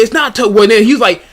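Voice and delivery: ordinary delivery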